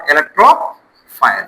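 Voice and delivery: drawn-out single word; slow dictation. Shown as a demonstration. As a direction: stretch the word for note-taking. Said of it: "കേട്ടോ, ഓർത്ത് നോക്കാം".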